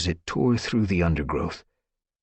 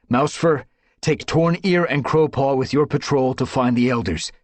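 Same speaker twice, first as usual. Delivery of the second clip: higher, loud orders; ordering; 8 semitones higher, 5 dB louder than usual. Acted. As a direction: command the patrol. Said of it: "he ordered".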